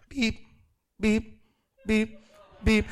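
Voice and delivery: high, clipped beep noises; mock register beeps, each one short; imitated scanner beeps. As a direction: mimic the checkout scanner beeping.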